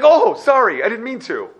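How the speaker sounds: high yelped sorry, then easing off; acted fluster, caught in the act; startled apology. Person apologizing to the screaming light, acted startled yelp.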